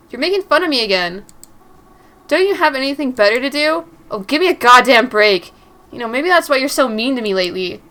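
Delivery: loud, defiant shout; angry shout back. Acted. Mary shouting back at her angry boyfriend. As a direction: throw it back at him.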